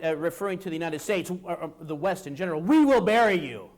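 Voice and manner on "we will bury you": put-on loud quote in own accent; declaimed quote. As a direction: proclaim it like a speech, loudly. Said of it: "gave a speech and said".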